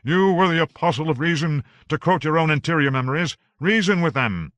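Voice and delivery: loud, high shout over a storm. A narrator's reading. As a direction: shout to be heard over the cyclone. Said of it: "Harry had to shout".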